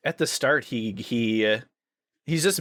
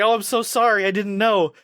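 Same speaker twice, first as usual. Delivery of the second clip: flustered apology; 9 semitones higher, 4 dB louder than usual. Retold or imitated. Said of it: "She's like".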